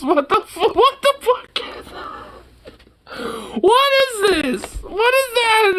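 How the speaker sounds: high, half-laughing what the fuck; enhancing the photo and cracking up in horror; laughing disbelief. Real, not acted. Squeal it through a laugh.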